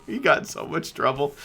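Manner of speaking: amused retelling, tickled by friends' trouble; laughing. Real, not acted. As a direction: laugh through the whole line.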